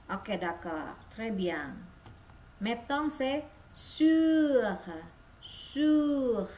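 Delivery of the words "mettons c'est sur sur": "Sur" with rounded lips, tongue at i; slow, exaggerated French u; careful model of French u. Shown as a demonstration. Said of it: "Perhatikan U".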